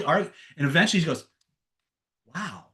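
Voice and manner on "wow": soft, stunned reaction; quiet amazement. Retold or imitated. Girlfriend finally realizing what he did, quietly amazed. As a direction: let it sink in, hushed.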